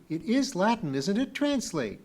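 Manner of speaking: demanding. A narrator's reading. Pee-wee pressing Kip to translate, demanding. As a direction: press for an answer, pitch up. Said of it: "demanded Pee-wee".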